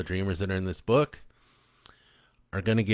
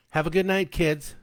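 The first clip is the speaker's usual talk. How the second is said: higher, bright singsong goodbye; cheerful mother; 8 semitones higher than usual. A narrator's reading. Mother calling goodbye to her kids, higher singsong. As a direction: call it out fondly on the way out.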